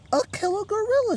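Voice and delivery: high, loud panicked squeak; frightened squeak. Acted. Mouse telling Panda about the killer gorilla, squeaking in fear. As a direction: squeal it in terror. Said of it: "she squeaked".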